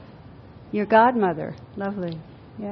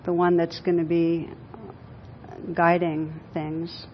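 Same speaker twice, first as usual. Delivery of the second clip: guided meditation; 3 semitones lower, 7 dB quieter than usual. Acted. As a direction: guide them gently inward, unhurried. Leads a guided visualization.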